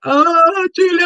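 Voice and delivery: high, mock-embarrassed whine; client's bashful protest. Coach imitating a client put on the spot, protesting. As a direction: draw it out high, as a reluctant client. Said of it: "And they're like".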